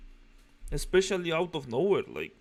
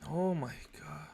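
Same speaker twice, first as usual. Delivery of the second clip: real unvoiced-sounding whisper; exasperated whisper; 8 dB quieter than usual. Real, not acted. Reactor despairing at a ruined story, exasperated whisper. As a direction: whisper it in disbelief.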